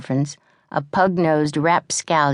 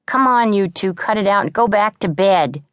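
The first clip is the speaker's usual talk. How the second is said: louder, firm command; 5 semitones higher, 4 dB louder than usual. A narrator's reading. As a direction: order them apart. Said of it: "commanded Annie".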